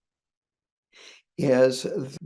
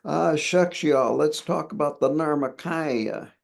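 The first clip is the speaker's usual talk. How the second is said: put-on hillbilly accent; 3 semitones higher than usual. Teacher imitating a Tibetan lama as a Southern hillbilly, light mock Southern accent. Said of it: "talking like this".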